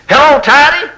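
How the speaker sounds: very high, loud mocking hello; teasing greeting. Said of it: "they say".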